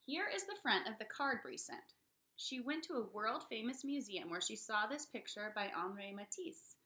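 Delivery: plain reading voice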